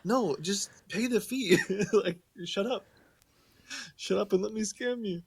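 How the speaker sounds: impatient scammer. Retold or imitated. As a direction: brush it off, laughing. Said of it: "they're like".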